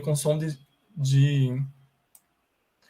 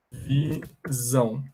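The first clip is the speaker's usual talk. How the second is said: "Visão" stretched syllable by syllable, a little softer; drawn-out word; 4 dB quieter, much slower than usual. Shown as a demonstration. Teacher writing the word visão for the class, drawn out. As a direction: say "Visão" slowly, vi then zão.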